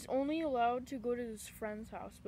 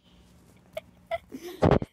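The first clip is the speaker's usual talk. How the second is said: loud burst of laughing; helpless laughter; 6 semitones higher, 14 dB louder than usual. Real, not acted. Friend laughing although so mad, loud helpless laugh. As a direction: crack up despite being mad. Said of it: "Why am I laughing?".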